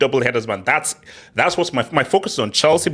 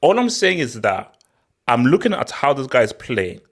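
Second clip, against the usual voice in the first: normal talking voice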